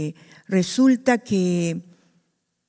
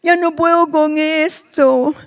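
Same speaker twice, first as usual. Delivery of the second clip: raised, slow, tearful whine; mock whimper; 6 semitones higher, 4 dB louder, slower than usual. A believer whining to the Lord, slow and tearful. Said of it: "No nos ponemos".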